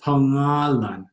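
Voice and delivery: slow "Pangalan" as pa-NGA-lan; drawn-out "Pangalan", soft g. Shown as a demonstration. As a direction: say "Pangalan" slowly, ng with no g release. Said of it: "Notice it's not a heavy G".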